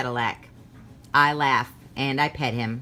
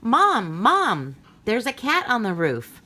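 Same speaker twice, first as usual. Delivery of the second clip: put-on high, loud child's call; a little kid shouting to mom about the cat; 5 semitones higher, 3 dB louder than usual. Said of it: "I called".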